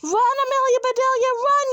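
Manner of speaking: high, loud, fast yelling; frantic cheering from the team; excited yell. A narrator's reading. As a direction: urge the runner on at a yell. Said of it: "yelled the boys".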